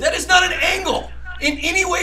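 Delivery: laughing at his co-host's teasing; laughing protest. Pretend. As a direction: deny it while cracking up. Laughing mock denial.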